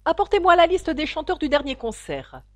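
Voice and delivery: high, loud royal command; imperious queen. A narrator's reading. The queen orders a court officer about, imperiously. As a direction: order it from the throne.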